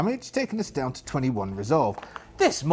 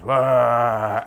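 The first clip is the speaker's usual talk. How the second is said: mummy wail; 3 semitones lower, 6 dB louder than usual. Acted. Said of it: "as it goes".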